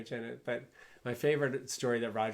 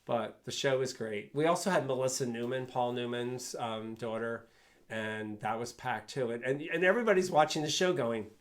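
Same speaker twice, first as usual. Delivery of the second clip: ordinary talk, nothing marked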